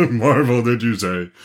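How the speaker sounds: scoffing gang-leader voice; scornful. Acted. Gang leader to the prince, scornful. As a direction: sneer it in a changed character voice. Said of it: "exclaimed the leader scornfully".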